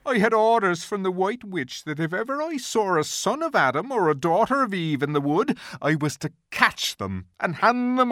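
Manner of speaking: high, strained faun voice rising to a loud cry; a frightened faun confessing, his voice climbing; agitated faun. A narrator's reading. Faun confessing his orders to Lucy, agitated and rising. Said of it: "the faun becomes increasingly agitated".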